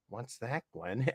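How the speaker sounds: low, hushed, wary reply; paranoid newsman. Retold or imitated. Newsman answering the host he must avoid, paranoid. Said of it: "paranoid guy".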